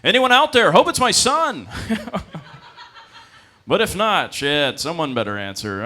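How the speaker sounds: loud and high, calling out to nobody; shouting into the void. Retold or imitated. Confused dad calling out into the void, loud and high. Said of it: "He's like".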